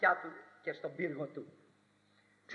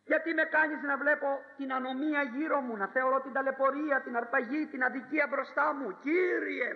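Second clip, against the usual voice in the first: higher, pleading cry to God; prophet's complaint; 5 semitones higher than usual. Acted. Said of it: "φώναζε ο προφήτης". (παραπονιάρικη κραυγή προφήτη)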